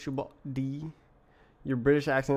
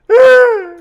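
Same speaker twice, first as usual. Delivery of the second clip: piercing high shriek, far louder; full-throated scream of fright; crying scream; 22 dB louder than usual. Real, not acted. Startled again by the screen, a piercing shriek. Second scream, even higher.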